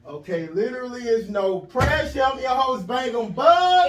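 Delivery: announcer intro. Acted. Host introducing the show loudly to listeners.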